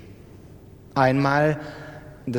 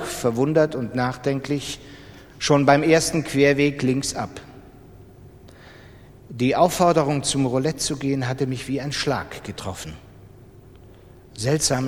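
ordinary delivery

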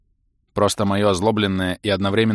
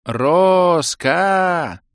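loud, high desperate yell; yelling at full lungs; 9 semitones higher, 5 dB louder than usual. A narrator's reading. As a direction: shout the name with all you have. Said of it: "снова крикнул".

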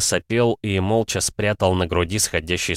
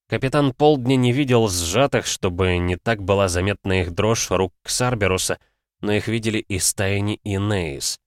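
ordinary delivery

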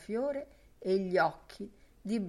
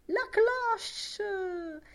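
high, louder jubilant shout; jubilant cry; 14 semitones higher, 4 dB louder than usual. Acted. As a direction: crow with delight. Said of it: "gridò l'Enean giubilante".

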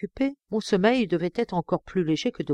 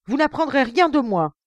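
emotional outcry; 7 semitones higher, 5 dB louder than usual. Acted. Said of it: "s'écria-t-il avec une émotion".

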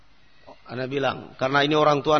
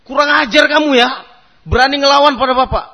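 higher, louder scolding shout; angry father; 6 semitones higher, 7 dB louder than usual. Retold or imitated. Father scolding his son for talking back, loud and furious. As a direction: snap at a disobedient son.

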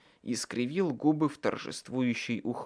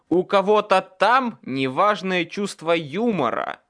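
loud, raised-pitch indignation; angry complaint; 6 semitones higher, 7 dB louder than usual. A narrator's reading. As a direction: shout it bitterly at heaven. Angry shout up at the sky. (громко, с возмущением)

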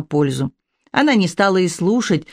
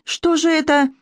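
much higher startled question; gasping in alarm; 10 semitones higher than usual. A narrator's reading. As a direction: ask it in sudden alarm. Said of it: "ахнула миссис Дэшвуд". (испуганный, гораздо более высокий вопрос)

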